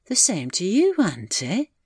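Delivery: ordinary delivery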